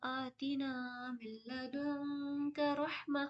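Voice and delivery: softly chanted Quranic recitation, slow and drawn; melodic recitation. Acted. Teacher recites the verse as a prayer, slow chant. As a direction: recite it as a prayer, tuneful and soft. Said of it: "दुआ के तौर पर मांगते हैं".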